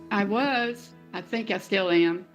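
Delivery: ordinary talk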